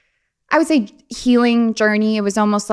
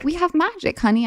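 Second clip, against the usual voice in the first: higher, sweet knowing mom; mom's voice; 9 semitones higher than usual. Retold or imitated. Mother telling her daughter, higher and sweet. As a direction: coo it like a mom sharing a secret. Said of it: "she'd be like".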